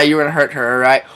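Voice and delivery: normal talking voice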